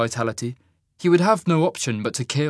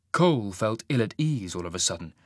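usual speaking voice

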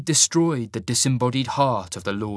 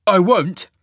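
loud, higher refusal; flat refusal; 8 semitones higher, 10 dB louder than usual. Acted. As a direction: slam the door on it. Cole refuses Marius.

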